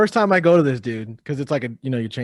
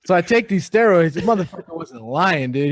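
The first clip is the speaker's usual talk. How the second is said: normal talking voice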